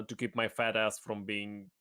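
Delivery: ordinary talk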